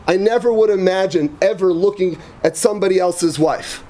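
ordinary delivery

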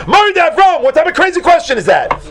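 high, loud outraged exclamation; Gemara going crazy. Acted. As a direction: leap up in disbelief at a crazy question. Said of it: "the Gemara is gonna jump up and go crazy".

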